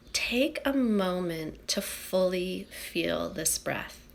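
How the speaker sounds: hushed, unhurried breath-by-breath guiding; guided meditation. Acted. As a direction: guide the breath gently, phrase by phrase, with long pauses.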